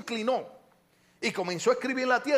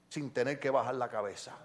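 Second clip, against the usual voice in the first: ordinary talk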